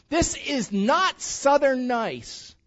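high, slow, every word hit hard; emphatic punchline. Real, not acted. Pastor driving home his point to the congregation, slow and emphatic. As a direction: land the punchline with weight. Preacher's punchline, long pause after.

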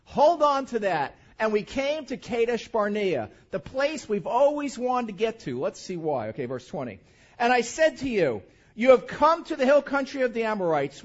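forceful pulpit reading; scripture reading. Acted. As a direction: proclaim the text to the congregation. Reads scripture aloud with force.

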